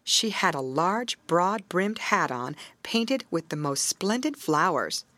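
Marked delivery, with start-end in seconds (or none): none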